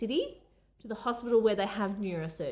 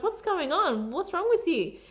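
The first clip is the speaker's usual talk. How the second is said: higher, louder, an alarmed doctor asking; concerned doctor; 8 semitones higher, 5 dB louder than usual. Retold or imitated. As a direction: voice the doctor startled by her tears. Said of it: "he was like".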